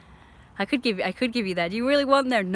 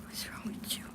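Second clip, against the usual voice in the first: whisper; 14 dB quieter than usual. Real, not acted. Whispering at misbehaving dog.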